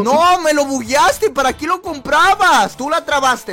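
loud, slow, high blaming; raging gamer. Real, not acted. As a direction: accuse your friend, loud and indignant. Blaming partner for glitch. (reclamo furioso de gamer)

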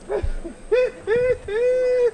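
high-pitched giggling laugh; high giggle. Acted. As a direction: giggle as you run away. Woman laughs and runs off.

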